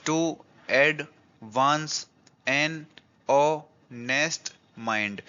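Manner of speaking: very slow counting beats on "टू, advance, एन, ओ, nest, mind"; syllable by syllable. Shown as a demonstration. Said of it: "तो इसमें भी कितने हो गए".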